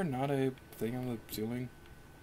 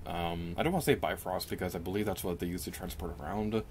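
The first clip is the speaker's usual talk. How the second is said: normal talking voice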